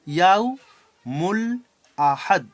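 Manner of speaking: "ইয়াউ, মূল, আহাদ" in separate chunks with pauses; syllable by syllable. Shown as a demonstration. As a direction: break "ইয়াউ, মূল, আহাদ" apart, pausing between parts. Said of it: "ভেঙে ভেঙে পড়বো".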